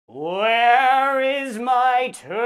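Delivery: drawn-out, groaning monster voice; eerie moaning creature calling for its toe; slow ghostly groan. A narrator's reading. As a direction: moan it very slowly from the dark. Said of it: "it groaned".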